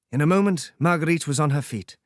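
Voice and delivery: ordinary delivery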